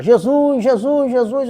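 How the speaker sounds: acted shout to get Jesus's attention; crying out. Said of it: "começa a gritar".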